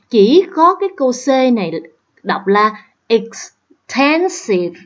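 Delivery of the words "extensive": "extensive" drawn out, stressed on TEN; second-syllable stress. Shown as a demonstration. Teacher showing the odd word out, second-syllable stress. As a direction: slowly, lean on TEN in "extensive". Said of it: "nhấn vô cái âm thứ hai".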